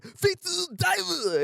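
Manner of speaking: hammed-up hero shouting the attack name; anime attack call. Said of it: "he said".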